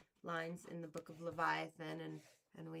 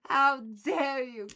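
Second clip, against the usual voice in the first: loud, slow hammed-up indignation; mock outraged yell; 4 semitones higher, 15 dB louder, slower than usual. Streamer hamming up fury at a viewer. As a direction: bellow it in fake fury. Repeated louder, slower.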